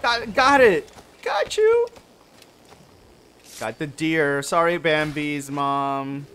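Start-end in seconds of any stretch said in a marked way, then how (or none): 0.0-1.9 very high, loud got you; victorious yelp after the catch; squealing win; triumphant shout. Real, not acted. Catching the deer.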